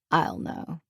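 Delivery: quietly firm, final refusal; lightly voiced husband, calm but firm. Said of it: "His tone took on a firmness".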